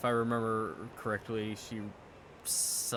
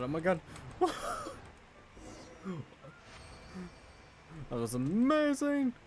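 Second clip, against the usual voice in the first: squealing, high-pitched delight; shrieking jackpot winner; 5 semitones higher, 3 dB quieter than usual. Real, not acted. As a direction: squeal like you won big. After a thrilling glide.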